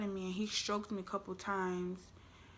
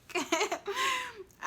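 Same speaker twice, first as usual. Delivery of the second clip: giggle; 14 semitones higher than usual. Real, not acted. Giggle after whisper.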